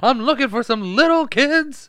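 high, loud villain voice breaking into laughs. Retold or imitated. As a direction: laugh through a hammed-up villain line. Quoting Judge Doom, laughing.

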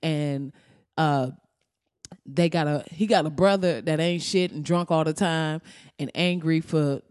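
ordinary talk, nothing marked

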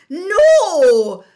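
high, loud, drawn-out cry; horrified cry. A narrator's reading. McGonagall learns it is a student, horrified cry. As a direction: cry out in horror. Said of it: "cried Professor McGonagall".

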